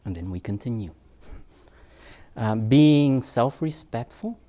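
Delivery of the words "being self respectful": slow, loud announcement with rising end; announcing. Real, not acted. Speaker announcing the next topic to parents, loud and drawn out. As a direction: draw out SELF-RESPECTFUL loudly, lifting the end.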